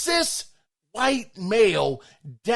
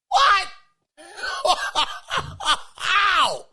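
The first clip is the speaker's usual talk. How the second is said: mock disbelief; 11 semitones higher than usual. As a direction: act out shocked outrage with a laugh. Mock outrage at being triggered.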